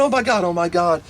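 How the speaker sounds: high-pitched retold wail; panicked screaming. Mother panicking over her choking baby, imitated high scream. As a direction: imitate mom screaming in alarm, sustained up high. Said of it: "She started screaming".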